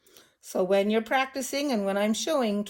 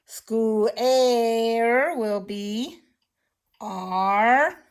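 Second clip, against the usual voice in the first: "Square" drawn out very slowly; stretched out slowly; much slower than usual. Shown as a demonstration. Teacher dictating, drawing the word out very slowly. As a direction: stretch "Square" so every sound is heard. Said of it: "giving it power".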